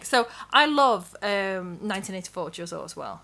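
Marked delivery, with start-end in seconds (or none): none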